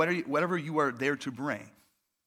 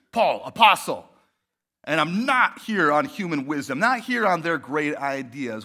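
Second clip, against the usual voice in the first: proud declaration; 3 semitones lower, 5 dB louder than usual. Retold or imitated. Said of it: "he goes right into who he's not".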